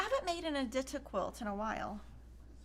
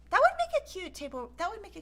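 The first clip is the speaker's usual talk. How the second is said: excited; 10 semitones higher, 5 dB louder, faster than usual. Real, not acted. Excited over cute fabric.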